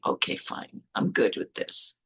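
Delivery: low, muted concession, acted out; quiet acceptance. Retold private acceptance of the book.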